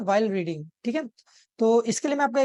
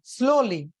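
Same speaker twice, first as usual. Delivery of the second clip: "Slowly" with SLOW punched, lee lighter; stress on first syllable; much slower than usual. Shown as a demonstration. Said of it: "ज्यादा जोर दे रहे हैं". ("Slowly" में "slow" पर ज़ोर)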